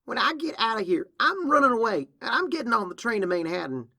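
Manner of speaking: high, loud threat in a quarrel; defiant outburst. Acted. Younger sister threatens to run away, defiant outburst.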